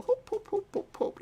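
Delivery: high, breathy mock chant of hope; mocking imitation. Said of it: "You always go".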